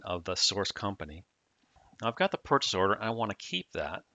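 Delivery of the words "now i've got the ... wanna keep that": ordinary talk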